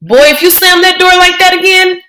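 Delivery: put-on old grandmother yelling; grandma yelling. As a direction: yell after the boy slamming the door. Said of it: "Grandma Sands yelling".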